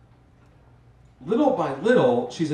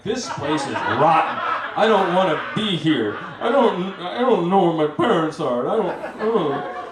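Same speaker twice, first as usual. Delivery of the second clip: loud, higher, hammed-up distress; mock panic; 5 semitones higher, 5 dB louder than usual. Backpacker suddenly desperate to leave Malaga, hammed-up panic. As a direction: play up a lost child's panic.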